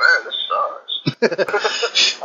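fed-up groan. Retold or imitated. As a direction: drag it out like you're sick of it. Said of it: "you just get to a point there like".

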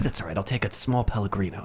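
low, soft, easygoing reassurance; calm, unbothered reply. Retold or imitated. Bill Murray answering the panicked cafe worker, calm and low-key. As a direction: drop down low to imitate an unfazed customer.

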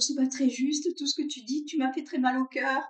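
son's wounded little protest, hammed up; imitating the little son. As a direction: play an eight-year-old complaining his feelings were hurt. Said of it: "Il dit toujours".

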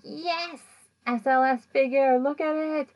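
high, thrilled squeal; excited. Retold or imitated. Excited reaction to the figure.

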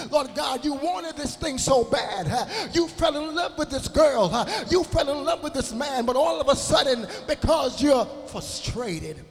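preaching. Acted. Preacher presses the congregation about their frustration. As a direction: preach it to the pews.

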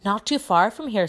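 excited. A narrator's reading. Seamus sharing news of a sighting, excited. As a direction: blurt it out excitedly. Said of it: "who looked excited".

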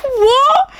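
imitated high-pitched, incredulous what; mock astonishment. Girlfriend stunned by the prisoner's claim, mock astonished. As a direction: squeal the question in disbelief, laughing. Acts girlfriend's shocked reply.

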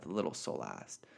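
ordinary delivery, nothing marked

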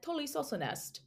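Tully saucer nest in a light Australian accent; a quick Aussie take on Tully saucer nest. Shown as a demonstration. Cohost shows the name in an Australian accent. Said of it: "when you say it in an Australian accent".

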